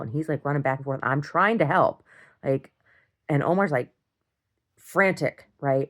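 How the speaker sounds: ordinary talk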